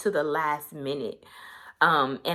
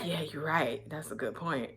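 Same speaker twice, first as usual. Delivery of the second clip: quiet agreement; 11 dB quieter than usual. Retold or imitated. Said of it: "he was like".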